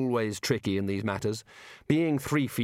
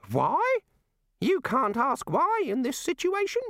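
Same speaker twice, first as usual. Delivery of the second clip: high indignant god voice; affronted squeak; high affronted little god; taken-aback god. A narrator's reading.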